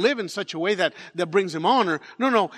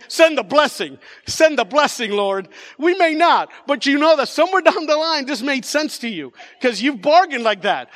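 high, loud mock bargaining with God; impassioned preaching; 7 semitones higher, 8 dB louder than usual. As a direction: preach it at full force, pleading and demanding. Preaches bargaining prayer at full force.

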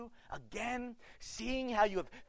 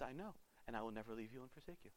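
tender reassurance; 8 semitones lower, 18 dB quieter, faster than usual. Pretend. God reassures the unworthy listener.

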